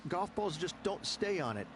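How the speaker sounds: ordinary talk, nothing marked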